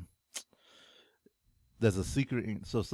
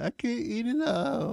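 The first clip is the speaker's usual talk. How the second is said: high-pitched mimicked whine; Renee; 13 semitones higher than usual. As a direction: imitate a friend in a much higher voice. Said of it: "Renee would have been like".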